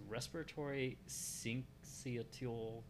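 "Respiratory syncytial" spelled out slowly and unsure; careful pronunciation. Real, not acted. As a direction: sound out "syncytial" syllable by syllable. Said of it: "I'm going to butcher this".

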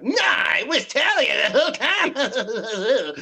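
high, loud, drawn-out crying mockery; hammed-up whine over the twist; mocking twist reveal. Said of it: "be like".

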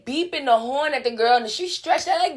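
ordinary delivery

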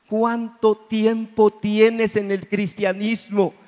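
slow, building to a loud shout; preaching shout. Real, not acted. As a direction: hammer the question home, slowly. Preacher challenges congregation, shouts.

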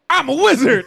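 high, loud proud declaration; mock wizard claim. Retelling Jericho in handcuffs, a high, loud boast. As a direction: announce it like a grand wizard. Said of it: "the bad guy... Chris Jericho".

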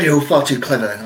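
ordinary delivery

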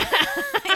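sudden high laugh; high burst of laughter. Real, not acted. Risa tras cita del niño. (carcajada aguda)

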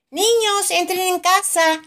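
put-on high, loud call to the children; calling from the door, high and loud. A narrator's reading. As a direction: call the kids indoors. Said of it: "lo llama Papá Pig".

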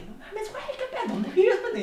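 mock-frantic mom and dad; panicked parents. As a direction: play the worried parents shouting down the phone. Parents voiced in the story.

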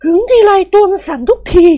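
high woman's voice with a forced smile. Acted. Wife admitting jealousy to her friend, forced smile. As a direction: admit it through a strained smile. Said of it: "ยิ้มแค่นๆ".

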